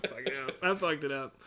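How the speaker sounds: normal talking voice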